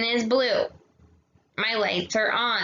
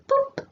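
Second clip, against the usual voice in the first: playful high boop. Shown as a demonstration. Marking the exclamation point.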